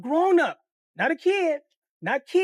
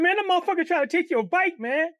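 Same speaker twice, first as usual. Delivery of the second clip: crying; 8 semitones higher than usual. Retold or imitated. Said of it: "He said".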